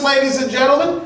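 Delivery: ordinary delivery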